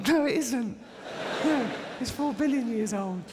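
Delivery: put-on high, indignant child's protest; indignant kid. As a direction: squeal it back like a know-all kid. Kid self retorts to teacher.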